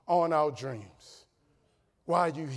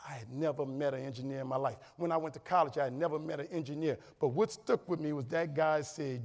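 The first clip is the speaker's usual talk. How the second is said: ordinary talk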